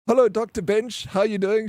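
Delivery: high, upbeat hello on the phone; cheery phone greeting. Retold or imitated. Patient answering the doctor's results call, acted cheerful greeting.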